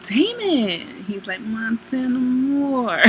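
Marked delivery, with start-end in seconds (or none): 0.0-1.0 imitated high, swooping greeting; delighted surprise. Woman turning to find Damon behind her, delighted surprise. She turns and sees him. 1.4-3.0 slow, drawn-out imitation of a suave man; flirty greeting.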